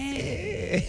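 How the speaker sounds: high, soft little groan through a smile; a tiny squeezed photo noise; light groan. Retold or imitated. Host mimicking cohost posing for photos: light groan. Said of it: "Like a light groan".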